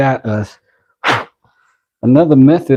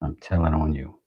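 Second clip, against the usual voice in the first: quiet teasing; 5 semitones lower, 10 dB quieter than usual. Acted. Repeating the threat softly.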